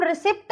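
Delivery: रिसिप्ट said with the P sounded; रिसिप्ट as ri-sipt; mispronunciation demo. Shown as a demonstration. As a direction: wrongly voice pt at the end of रिसिप्ट. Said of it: "नहीं बोलेंगे".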